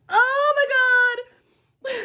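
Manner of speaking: high, loud, drawn-out cry; stretched, high-pitched groan of despair at yourself; exasperated wail. Real, not acted. Exasperated at her own gullibility.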